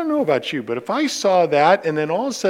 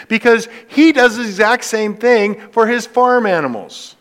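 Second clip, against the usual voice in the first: preaching at full force; 8 semitones higher, 6 dB louder than usual. Real, not acted. Preaching climax.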